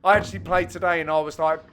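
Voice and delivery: ordinary talk, nothing marked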